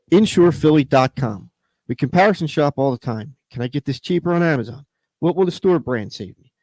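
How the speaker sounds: steady, clear sponsor read. Acted. Host reads the insurance ad to listeners. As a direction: read the spot like a radio ad.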